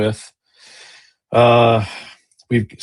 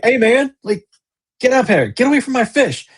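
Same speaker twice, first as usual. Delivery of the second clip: high, louder call at a thief; retold yell; 14 semitones higher, 3 dB louder than usual. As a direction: shoo the raccoon off, voice way up. Yelling at raccoon over fish.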